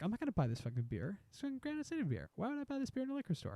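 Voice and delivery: mock customer grumbling, high and fast but hushed; grumbling shopper. Scoffing shopper dismissing the local beer, high and fast. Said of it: "somebody's like".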